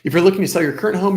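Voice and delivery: normal talking voice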